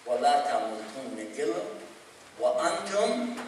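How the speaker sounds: sermon preacher recites verse to the congregation, chanted; quran recitation. Acted. تلاوة قرآنية مرتلة. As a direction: chant the verse in Arabic, rising to a soft close.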